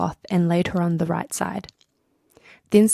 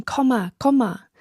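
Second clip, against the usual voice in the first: calling a goose by name; 5 semitones higher, 4 dB louder than usual. A narrator's reading. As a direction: call "Comma" out loud. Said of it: "kept calling".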